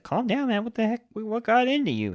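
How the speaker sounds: hammed-up high-voiced plea to calm down; mock Nick Cage freakout. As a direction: play it as a jittery, overblown Nick Cage. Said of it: "Nick Cage is like".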